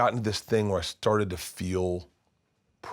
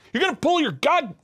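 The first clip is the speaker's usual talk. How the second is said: put-on very high, loud, frantic old man yelling; imitating dad; 6 dB louder than usual. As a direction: shriek for your wife to bring the weed.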